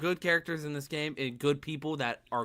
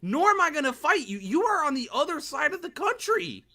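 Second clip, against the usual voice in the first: higher, louder mock indignation; high-pitched; 11 semitones higher, 5 dB louder than usual. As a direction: protest in a raised voice.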